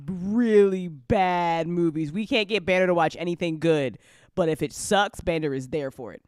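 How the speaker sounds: normal talking voice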